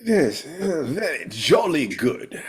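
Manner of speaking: mock posh English accent, slowed down; playful fake British accent, stretched out. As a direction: put on a jolly British accent for fun. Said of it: "in a fake English accent".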